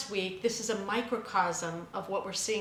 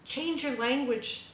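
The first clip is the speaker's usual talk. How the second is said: usual speaking voice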